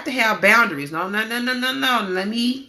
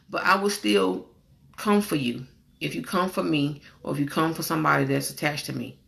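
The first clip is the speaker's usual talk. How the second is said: ordinary talk, nothing marked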